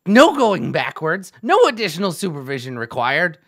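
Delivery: a goblin boss reciting rules; officious goblin. Retold or imitated. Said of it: "kinda turns back around and is like".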